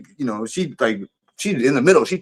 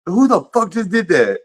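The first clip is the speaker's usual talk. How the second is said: much higher, startled outburst; shocked what-was-that; 7 semitones higher than usual. Retold or imitated. Telling his homeboy after the bottle hits him, startled and higher. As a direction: blurt it out stunned. Retelling being hit by bottle.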